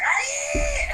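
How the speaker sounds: high shrill little creature yell; Ewok war cry. Acted. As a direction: squeal it like a tiny furious warrior. GM voices attacking Ewok.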